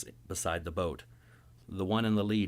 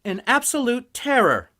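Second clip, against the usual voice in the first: much higher, loud and grating; grating wife; 13 dB louder than usual. Acted. Wife complaining to her husband about the kids, grating and loud. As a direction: grate it out at him. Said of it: "my wife grates at me".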